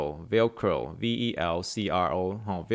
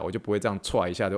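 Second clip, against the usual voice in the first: normal talking voice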